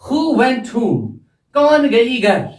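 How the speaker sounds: Who went home? asked very slowly, word by word; slow teacher question. Shown as a demonstration. Teacher poses the question to the students, very slow. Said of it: "मैं कहूंगा".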